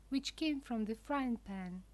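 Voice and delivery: usual speaking voice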